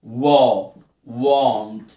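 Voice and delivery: "وا" then "وانت" with long open aw; AW sound drill. Shown as a demonstration. Teacher drills A after W, long "وا، وانت". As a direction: punch "وا", then build it into "وانت" as want. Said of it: "بنطق ال A O".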